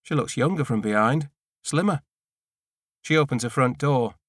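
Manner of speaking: plain reading voice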